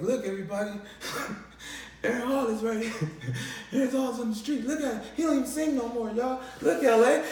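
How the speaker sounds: high, laughing hype-man announce; mock live-stream announcer; mocking livestream host. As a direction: roast him for the camera.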